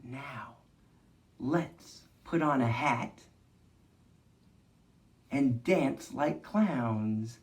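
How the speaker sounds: soft, slow storytime voice; hushed, unhurried teacher leading a movement game; gentle guided movement. Acted. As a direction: guide the kids calmly through each shape.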